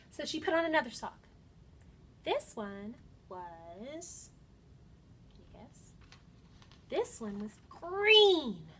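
animated storybook voice with dramatic pauses; reading to children. Acted. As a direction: read it like story time, building suspense. Reads picture book to children.

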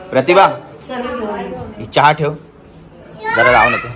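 husband calling wife to hurry. Real, not acted. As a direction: call your wife from another room.

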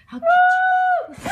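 playful vow shouted at the screen; slow shouted threat. Real, not acted. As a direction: stretch it out like a promise of revenge. Threatening a game enemy.

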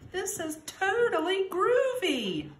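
high, loud delighted cry; excited cry. Acted. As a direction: whoop it with cool delight, pitched high. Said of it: "Pete the Cat cried".

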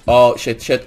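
ordinary talk, nothing marked

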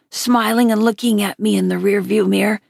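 ordinary delivery, nothing marked

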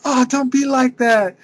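playful high impression, drawn out. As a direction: whine it back like a wounded star. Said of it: "And then he's like".

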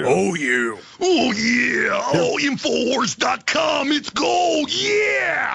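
high raspy wrestling shout; Macho Man voice. Pretend. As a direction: growl it like a wrestling promo. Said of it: "That's more like Macho Man".

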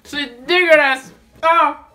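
slow, loud drawn-out disgust. Real, not acted. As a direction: stretch it out in revulsion. Tasting the chocolate.

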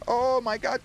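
usual speaking voice

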